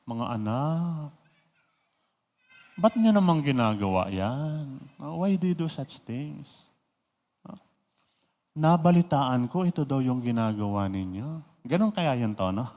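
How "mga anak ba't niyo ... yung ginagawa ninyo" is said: put-on a weary old father softly scolding his sons, well below his usual level; gentle father. Shown as a demonstration. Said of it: "ano kayang tono ni Eli". (malumanay at mabagal na pagsaway ng ama)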